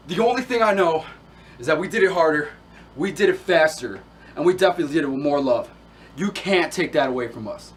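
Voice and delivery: forceful, loud declaration at normal pitch; proud, emphatic end to a rant; loud defiance. Acted. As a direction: drive each point home loudly.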